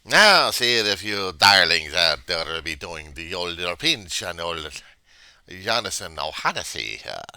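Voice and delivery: loud, slow stage Irish announcement; Irish accent. Pretend. Vlogger playing Jonathan O'Hanesey for viewers, in a loud mock Irish accent. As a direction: proclaim it like a jolly Irish host. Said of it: "I don't know how to do this accent".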